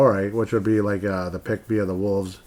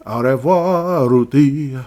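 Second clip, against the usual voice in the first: loud, high, drawn-out French farewell; big hammed-up send-off; mock farewell; 5 semitones higher, 9 dB louder, much slower than usual. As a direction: sing out a playful goodbye, slowly. Mock farewell to Rudy Gobert.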